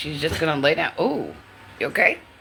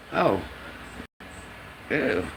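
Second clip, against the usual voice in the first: ordinary talk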